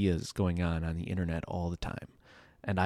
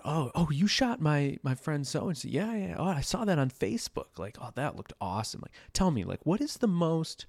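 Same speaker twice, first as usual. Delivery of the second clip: lightly imitated gushing acquaintance, high-pitched; mock eager admirer; 8 semitones higher than usual. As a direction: play the keen friend pumping for stories. Acts out admiring small-talkers.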